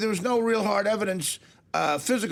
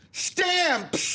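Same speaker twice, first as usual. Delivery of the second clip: high, camp shout of the word Stamps; effeminate postal worker; 11 semitones higher than usual. Acted. Comic showing off his character to a talk host, high camp shout. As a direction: sing out Stamps like a flamboyant postal clerk. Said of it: "that is my feminine postal worker".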